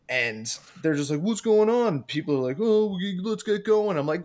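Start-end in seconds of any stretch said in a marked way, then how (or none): 1.2-2.0 high, louder mock-panicked question; retold panic. Passengers trapped on a smoking train, retold panicky cry. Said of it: "I'm freaking out". 2.6-4.0 high-pitched urging of a frightened crowd; retold panic. Passengers wanting out of the tunnel, retold panicky urging. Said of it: "I'm freaking out".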